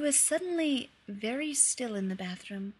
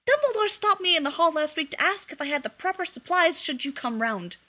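loud rant; 5 semitones higher, 7 dB louder than usual. A narrator's reading. Sirius, still shouting at Remus, rants about who knows. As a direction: list them off, fed up and shouting. Sirius keeps ranting loudly.

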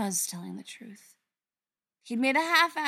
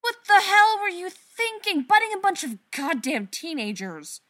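loud, high enraged tirade; furious yelling; 11 semitones higher, 11 dB louder than usual. Acted. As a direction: rage at him, full volume. Sister yelling at reckless brother.

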